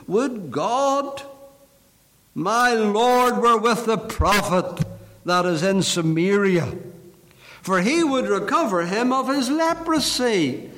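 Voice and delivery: high, ringing pulpit proclamation; full-force preaching. Real, not acted. Preacher extolling the little captive girl to the congregation, full-force preaching. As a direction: proclaim it slowly, driving each phrase home.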